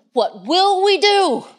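high, slow fretting cry; hammed-up worry; mock panic. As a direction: play the anxious disciple wringing hands. Voicing the fretting disciples.

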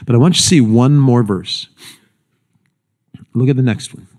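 ordinary talk

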